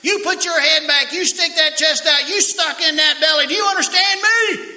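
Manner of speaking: high, loud barked commands; drill instructor yelling. Retold or imitated. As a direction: tear into the lazy recruit. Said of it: "he said / jumped all over that boy".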